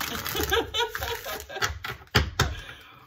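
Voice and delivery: high, airy burst of laughter; real giggle that runs out of breath; wheezing laugh. Real, not acted. Laughing at her own impression.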